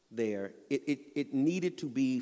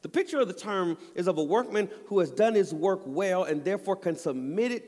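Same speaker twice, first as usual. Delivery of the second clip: higher, louder full-force sermon; preaching; 6 semitones higher, 6 dB louder than usual. Acted. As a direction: drive the scripture home from the pulpit.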